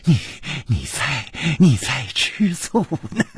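giggling through the words, halting; broken, chuckling taunt; amused. Acted. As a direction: laugh softly, then tease between breaths. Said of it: "嘴角却泛起了微笑".

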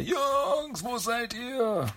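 high, drawn-out call for the guys; tied-up Mikey calling for his missing brothers. Retold or imitated. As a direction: cry out for your friends from far away. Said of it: "Und dann schreit er".

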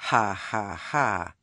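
solemn, joyless ha-ha-ha in character; deadpan laugh, each syllable set down evenly. A narrator's reading. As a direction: give a mock laugh with total solemnity. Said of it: "said Gammer solemnly".

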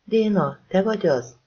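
high, thin question; put-on thin, high voice on the phone; thin small voice. A narrator's reading. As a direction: pitch it up as thin as you can. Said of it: "olyan vékony hangon, ahogy csak tudta".

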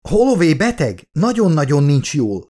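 high, alarmed shout; frightened shout. A narrator's reading. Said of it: "kiáltott rá a kapitányra rémülten". (rémült, magas hangú kiáltás)

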